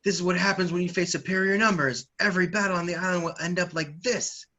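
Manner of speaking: rally the troops with a loud, high voice; hammed-up battle boast; Sasaki. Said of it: "But Sasaki yells out".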